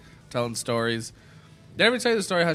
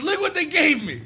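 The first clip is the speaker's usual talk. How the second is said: high, laughing mock boast; triumphant drunk mom; 11 semitones higher, 3 dB louder than usual. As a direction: show off the loot way up high. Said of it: "and she goes".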